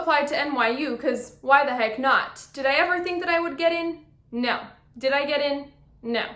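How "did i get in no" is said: ordinary talk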